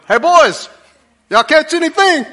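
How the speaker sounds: high, loud call from the shore; teasing call. Retold or imitated. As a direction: holler to the boys in the boat, ribbing them. Jesus calling from the seashore.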